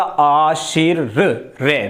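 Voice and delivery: आशीर रे drawn out very slowly, the र sound held after आशी. Shown as a demonstration. Teacher showing pupils where र sounds in आशीर्वाद, very slow. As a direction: stretch आशीर रे syllable by syllable to show where र is heard. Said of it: "इसका उच्चारण कहाँ होगा".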